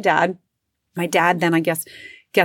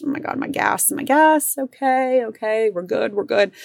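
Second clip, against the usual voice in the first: sudden high yelp on my gas, then high shaky settling okay; mock alarm then talk yourself down; reliving a car scare, panicky then calming herself; retold panic; 4 semitones higher than usual. Panics over gas gauge in car.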